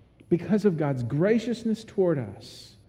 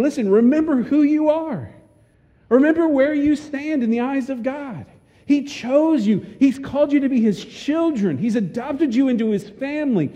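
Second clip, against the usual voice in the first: raised, high-pitched preaching voice; impassioned preaching; 6 semitones higher than usual. Real, not acted. Preacher urging the church to remember who they are, impassioned. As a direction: press each phrase home to the congregation, high and insistent. Preacher builds to a rallying climax.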